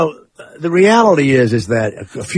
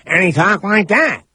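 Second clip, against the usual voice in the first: put-on higher, odd-sounding voice of an old writer; imitating the real Trumbo; 6 semitones higher than usual. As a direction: mimic the real man's strange voice, pitched up. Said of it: "at the end of the movie, they play Dalton Trumbo".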